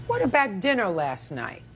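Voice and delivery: normal talking voice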